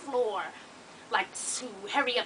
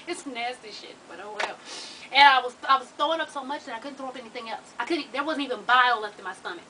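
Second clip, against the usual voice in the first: normal talking voice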